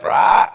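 loud, short "rah" squawk. Acted. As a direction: let out a loud mock cry. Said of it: "or I could go like".

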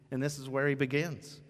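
ordinary delivery

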